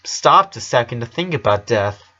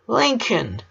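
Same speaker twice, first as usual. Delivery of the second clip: higher sudden call from behind; spooky sudden call; 11 semitones higher than usual. Acted. Lucy startles her brother from behind, sudden high call. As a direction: pop up behind him. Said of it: "suddenly said a voice behind him".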